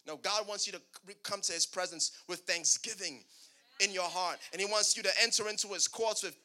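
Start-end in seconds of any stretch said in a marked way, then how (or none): none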